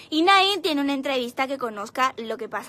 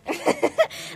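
high laugh; 3 dB louder than usual. Real, not acted. Laughs at her own joke.

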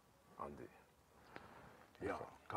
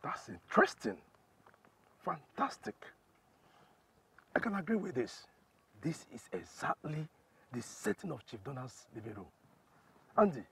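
loud, raised, gleeful approval; excited delight; 9 semitones higher, 10 dB louder than usual. Acted. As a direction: relish the news with a high, loud voice. Boss delighted by damning evidence.

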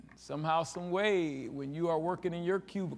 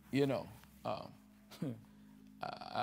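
usual speaking voice

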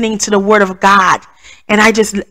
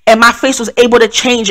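normal talking voice

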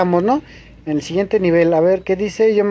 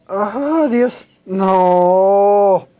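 anguished shout; much slower than usual. Real, not acted. Gamer falling at the end, anguished shout. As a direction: yell as it all slips away. Falls again after long attempt.

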